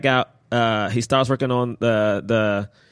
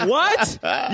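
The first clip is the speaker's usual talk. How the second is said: shocked what; 8 semitones higher than usual. Retold or imitated. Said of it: "I was like".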